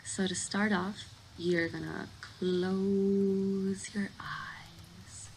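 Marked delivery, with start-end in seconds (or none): 2.2-5.3 "close" stretched out very slowly; drawn-out instruction. Real, not acted. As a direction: stretch "close" long and ease into "eyes". Easing listener into the exercise.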